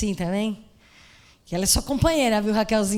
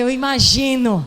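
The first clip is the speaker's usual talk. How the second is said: louder, drawn-out "imagino" with wry irony; ironic envy; 8 dB louder than usual. Retold or imitated. Scared pastor answers the calm woman with wry, drawn-out irony. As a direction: say it kindly but envying her peace. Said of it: "Que eu não tava, né?".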